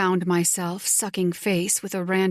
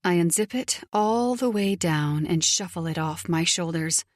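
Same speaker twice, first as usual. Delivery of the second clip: plain reading voice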